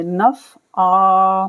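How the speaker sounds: नफ़अ ending on a long aa and stopped; stopping on the long aa. Shown as a demonstration. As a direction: say नफ़अ with the ain as a drawn aa, then stop. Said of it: "आइन अलिफ ज़बर आ पढ़ के stop कर देंगे".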